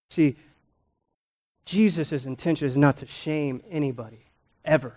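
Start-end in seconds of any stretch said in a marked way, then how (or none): none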